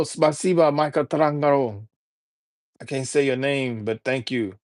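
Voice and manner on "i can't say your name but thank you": ordinary delivery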